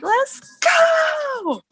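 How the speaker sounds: very high, stretched-out hype cry; long high-pitched whoop of triumph; cheering. Retold or imitated. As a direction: re-enact a theater cheer, pitch soaring. Re-enacting cheer in the theater.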